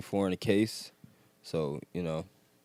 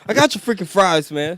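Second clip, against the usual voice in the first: very high, loud comic outburst; high-pitched mock complaint, loud; mock fry cook; 12 semitones higher, 10 dB louder than usual. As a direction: holler it like a hammed-up fry cook.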